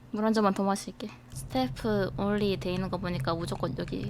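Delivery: hushed whisper, barely voiced. Real, not acted. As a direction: whisper so nobody hears. Sneaking past in the game.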